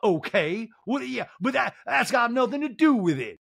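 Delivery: high flustered protest; lightly voiced defensive Sutton. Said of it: "And Sutton's like".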